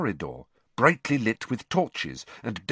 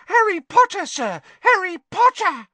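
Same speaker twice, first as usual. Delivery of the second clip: very high, loud, thrilled squeal; squealing elf; 8 dB louder than usual. A narrator's reading. Dobby the elf hurtling toward Harry, squealing. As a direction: squeal the name in elfin delight.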